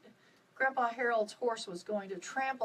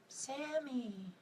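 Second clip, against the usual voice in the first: soft call; 3 semitones higher, 8 dB quieter than usual. A narrator's reading. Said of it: "Someone called her name".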